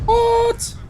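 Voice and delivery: high incredulous what; surprised what. Real, not acted. Streamer baffled by a chat message, high what. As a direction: squeak it in disbelief.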